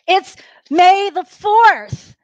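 drawn-out proud reveal; big announcement. Real, not acted. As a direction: announce it like a holiday. Announcing Star Wars day to class.

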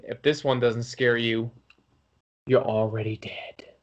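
low, hushed horror-host sign-off; creepy whisper on already dead; spooky sign-off. Acted. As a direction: drop low and breathe the last words. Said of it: "This is [name] saying".

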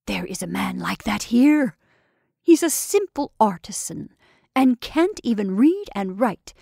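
ordinary delivery

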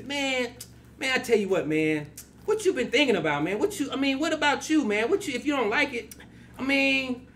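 high-pitched comic imitation; mailman's off-air voice. Mailman advising him about his name, high imitated voice. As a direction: talk high, piling on the 'man's. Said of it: "he do this".

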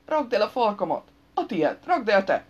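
loud, punchy squabble; heated bickering. A narrator's reading. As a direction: snap the order back and forth. Friends quarrel, throwing orders back. (hangos, ingerült civódás)

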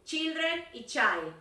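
teacher spelling out the two forms; slow pronunciation model. Shown as a demonstration. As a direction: contrast "Children" and "child" clearly. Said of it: "Dani, lo mismo".

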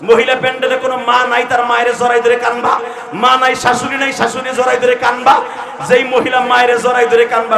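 loud, high exhortation; fiery preaching. Real, not acted. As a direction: command the crowd to hold on and weep. Preaching at full force.

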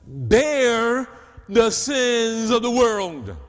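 loud, high preaching build; preaching at full force. Acted. Preacher lifting the congregation toward Gethsemane, full force. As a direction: drive it home like a revival preacher.

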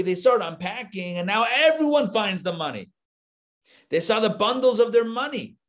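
ordinary talk

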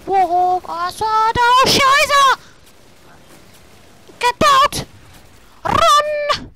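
high, loud alarmed yells; frantic shouting. Real, not acted. Gamer escaping from trouble, frantic high shouting. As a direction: shout as if disaster just struck. Gamer panics in vehicle action.

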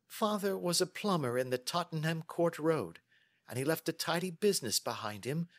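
high-pitched, earnest woman's voice; chatty lady client, mildly aggrieved. Acted. Client tells Holmes of her mother's remarriage, mildly aggrieved. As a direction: ramble through the family grievance. Miss Sutherland tells family history.

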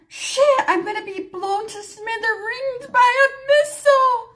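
high, hammed-up panicky outburst; mock panic. Acted panic at front desk.

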